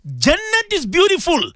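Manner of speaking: fervent preaching. Acted. Radio host swearing to listeners that paradise is beautiful, fervent and high. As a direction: lift Jannat is beautiful high and let beautiful ring down. Preacher repeating the line with fervour.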